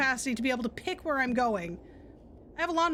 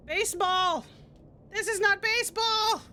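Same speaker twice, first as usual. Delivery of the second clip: frustrated shout; 7 semitones higher than usual. Real, not acted. Gamer sent to baseball again, real frustrated shout. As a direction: yell it at the game in real annoyance. Gamer rages at wrong destination.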